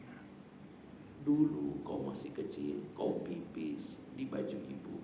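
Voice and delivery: tender mother's note. Retold or imitated. Preacher reading the mother's note to her daughter, tender and soft. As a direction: read it like a mother's last words to her child.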